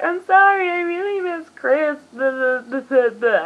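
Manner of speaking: high, whiny mock sob, slowing down; hammed-up tearful apology; mock crying girlfriend. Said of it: "and she's like".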